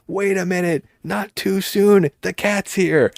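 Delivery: high mock alarmed hero. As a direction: play the hero's dumb alarm, slow in the middle. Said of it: "He goes".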